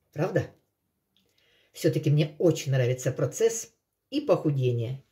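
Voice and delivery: ordinary talk, nothing marked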